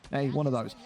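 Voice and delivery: ordinary delivery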